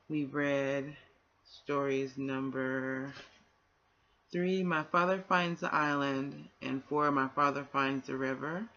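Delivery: plain reading voice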